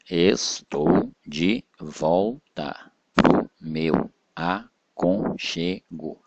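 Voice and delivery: "Estou de volta pro meu aconchego" split into slow, separate syllables. Shown as a demonstration. Teacher splitting a verse for pupils, syllable by syllable. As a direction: break every syllable apart with a pause. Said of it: "separação de sílaba normal".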